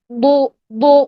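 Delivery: "بو" as a heavy Doo, tongue sides at the molars; Dad from the back. Shown as a demonstration. Teacher correcting students, "بو" heavy and from the back. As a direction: press "بو" back into a thick Doo. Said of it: "پیچھے سے".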